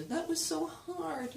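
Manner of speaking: high, slow complaint; habitual complaint melody. Shown as a demonstration. Body caught in the packaged melody, high complaint. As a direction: stretch it out high with "hard" falling. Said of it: "Our body gets into".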